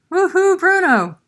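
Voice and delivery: high, loud cheer; excited storybook cheer. Acted. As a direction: whoop for the dancing cat. Cheering the dancing cat.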